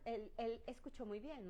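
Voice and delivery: ordinary talk, nothing marked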